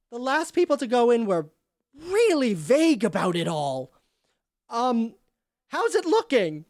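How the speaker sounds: Andera voiced. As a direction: play Andera, nervous and vague. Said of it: "you can hear Andera say".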